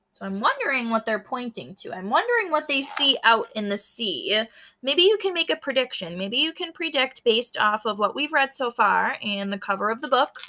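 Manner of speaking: normal talking voice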